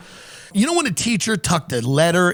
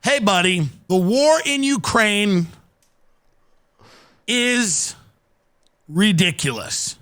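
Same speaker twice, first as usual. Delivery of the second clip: dramatic letter read; 3 semitones higher, slower than usual. Acted.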